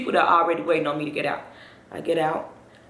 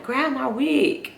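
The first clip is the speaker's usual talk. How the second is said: higher, drawn-out comic cry; calling out; 7 semitones higher, 4 dB louder, much slower than usual. Retold or imitated. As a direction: imitate calling urgently for the wig. Retold calling out while leaving.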